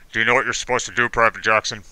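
low, firm drill sergeant bark. Retold or imitated. Drill sergeant questioning a recruit, low and firm. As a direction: grill the recruit like a drill instructor.

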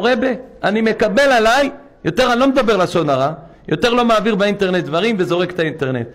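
hammering the congregation; fiery preaching. Acted. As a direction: thunder it from the pulpit. Preacher rises again, rebbe's vow.

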